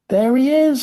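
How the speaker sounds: very high, loud cry from the crowd; shouting. A narrator's reading. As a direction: shout it high, spotting someone at last. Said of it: "somebody shouted".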